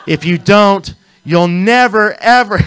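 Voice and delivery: high, very loud hammed-up warning; playful over-the-top yelling; teasing mock threat. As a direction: shout it as a joking threat. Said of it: "I'm teasing".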